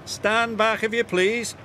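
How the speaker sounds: high, polite warning call. A narrator's reading. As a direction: call out high, without extra volume. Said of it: "called Oliver".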